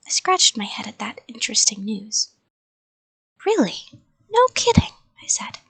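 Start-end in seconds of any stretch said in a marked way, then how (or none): 3.4-4.9 high-pitched, loud astonishment; surprised child. A narrator's reading. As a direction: react as if this is news to you. Child narrator reacts to news.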